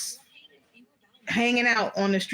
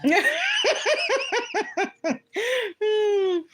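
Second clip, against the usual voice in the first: long high-pitched laugh; 13 semitones higher than usual. Real, not acted. Cousin teases her about acting like him, high laughter.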